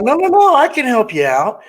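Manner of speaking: high, quick no no no; mock-eager saleswoman protest; eager insistence. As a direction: jump in brightly to help. Eager saleswoman insisting she helps.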